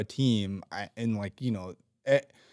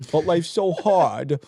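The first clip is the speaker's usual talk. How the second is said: put-on baby accent; 8 semitones higher, 3 dB louder than usual. Mocking whiny young coworkers in a high baby voice. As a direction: pout it out like a spoiled toddler. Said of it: "it's my baby accent".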